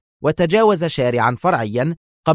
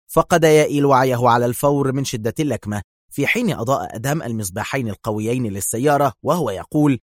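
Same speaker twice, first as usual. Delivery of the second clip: ordinary delivery, nothing marked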